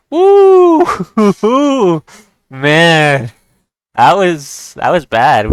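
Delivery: high, loud whoop breaking into a laugh; relieved whoop. Real, not acted. Chess player celebrating a comeback win, excited and relieved. Celebrates winning after losing a queen.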